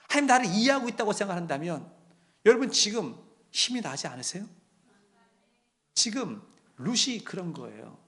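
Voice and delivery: usual speaking voice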